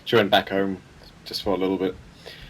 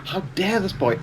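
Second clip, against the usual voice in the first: higher, incredulous mock outrage; exasperated outrage; 8 semitones higher than usual. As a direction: throw it up in disbelief. Repeats with more outrage.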